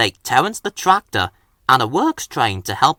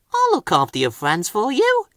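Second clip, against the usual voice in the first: put-on higher eager young engine; bright promise to a friend, pitched up; promising; 7 semitones higher than usual. A narrator's reading. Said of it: "Percy promised".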